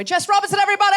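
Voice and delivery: high, loud call to the audience; rousing announcement. Real, not acted. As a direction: whip up applause for a departing guest. Host calls for applause.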